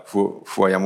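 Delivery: usual speaking voice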